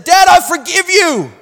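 high, loud, voice cracking with emotion; breaking into tears. Retold or imitated. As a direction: cry it out as the hurt finally breaks. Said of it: "I broke and I started sobbing".

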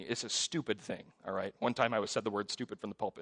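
usual speaking voice

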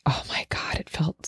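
whispered, heartfelt exclamation; awed whisper. Real, not acted. Woman recalling how healing the breathing felt, whispered awe. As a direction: drop to a soft whisper.

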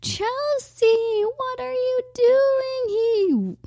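put-on high, slow old lady voice; drawn-out shocked grandma. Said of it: "my grandma would come up to me and be like".

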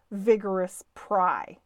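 ordinary delivery

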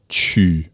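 tu with the rounded French u sound. Shown as a demonstration. Teacher modelling the French u sound for learners. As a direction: say tu with lips rounded, tongue forward. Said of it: "it's pronounced".

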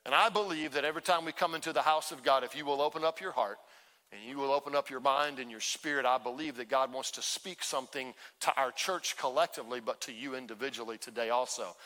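ordinary delivery

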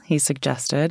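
usual speaking voice

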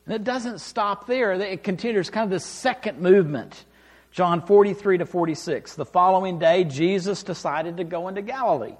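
ordinary delivery

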